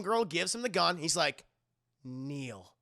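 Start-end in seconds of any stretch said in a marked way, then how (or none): 2.0-2.7 low quiet command. Retold or imitated.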